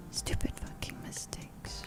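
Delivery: barely audible, slow whisper; slow, voiceless whisper of regret; whispered self-blame. Real, not acted. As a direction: curse yourself under your breath.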